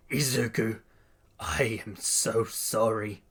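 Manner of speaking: slow, subdued apology; remorseful apology. A narrator's reading. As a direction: apologise heavily and slowly. Bakugo apologises on his knees.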